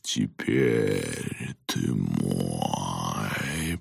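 very deep, extremely drawn-out ghoulish voice; talking skull, slow and ominous; sinister monster voice. Acted. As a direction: stretch each word low and menacingly. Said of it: "повторил голос".

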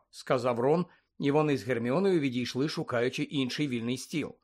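plain reading voice

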